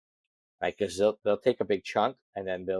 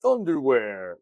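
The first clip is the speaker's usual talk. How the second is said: booming announcer voice, higher and louder; monster truck ad; 4 semitones higher, 5 dB louder, slower than usual. Shown as a demonstration. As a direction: belt it out like a monster truck rally promo. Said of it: "I blurted out in a monster truck voice".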